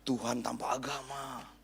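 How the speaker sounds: ordinary talk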